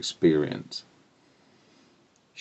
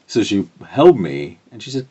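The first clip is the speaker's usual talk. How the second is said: ordinary talk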